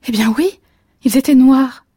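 eager cry of triumph; exclaiming. A narrator's reading. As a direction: blurt it out, thrilled to be right. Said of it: "s'exclama-t-elle".

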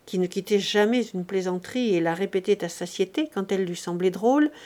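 ordinary delivery, nothing marked

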